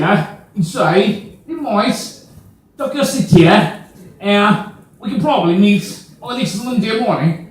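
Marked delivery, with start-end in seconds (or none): 1.5-7.4 put-on Cockney, pitched higher; Cockney accent. Locals invite a newcomer to sit, in Cockney accent. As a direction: greet a mate in broad mock Cockney. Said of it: "an accent called as the Cockney".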